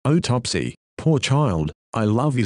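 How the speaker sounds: usual speaking voice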